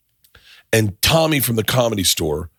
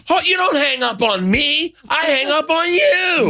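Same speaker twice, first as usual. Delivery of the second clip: high, slow, drawn-out mock rage; retold rage; slower than usual. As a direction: imitate a furious booker, stretching every word. Retold booker rages at hang-up.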